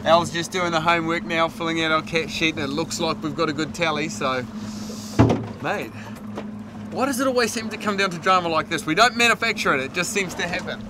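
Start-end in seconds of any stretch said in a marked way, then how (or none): none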